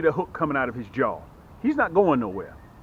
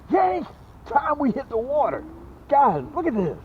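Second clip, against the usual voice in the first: excited yank; 9 semitones higher than usual. Real, not acted. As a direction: yell it as the fish hits. Excited hookset.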